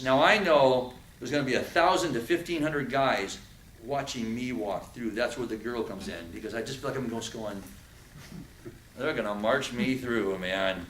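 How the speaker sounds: ordinary delivery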